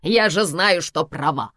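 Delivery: loud, indignant retort, settling at the end. A narrator's reading. Karina denies any argument with Samvel, indignant. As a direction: protest as the one who is right. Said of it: "возмутилась Карина".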